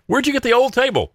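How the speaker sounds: high-pitched put-on questioner; high, mock-curious question; imagined curious visitor. Acted visitor admiring the stand.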